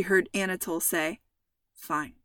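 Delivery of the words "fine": low, soft mumble; a quiet, reluctant mumble of a single word; mumbled. A narrator's reading. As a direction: mumble it under your breath. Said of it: "Xavier mumbled".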